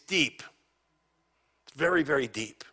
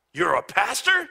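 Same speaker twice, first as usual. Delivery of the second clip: loud surprise; 6 semitones higher, 7 dB louder than usual. Retold or imitated. Richard voiced, surprised and loud.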